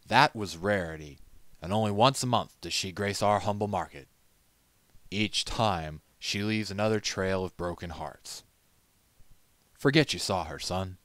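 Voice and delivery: loud, gruff-free fatherly merchant; old merchant. Acted. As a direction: warn the young stallion kindly but firmly. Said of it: "He laughed again".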